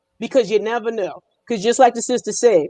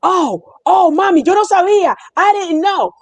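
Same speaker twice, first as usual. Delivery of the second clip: higher, louder, caught-out gushing; flustered backpedal; 6 semitones higher, 4 dB louder than usual. Retold or imitated. Store clerk caught gossiping, backpedaling to her in flustered surprise. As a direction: act suddenly sorry you got caught.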